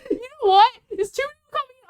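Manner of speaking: high loud excited outburst; excited give-in. Real, not acted. Streamer giving in as viewers keep arriving, high and loud. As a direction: burst out, giving in gladly.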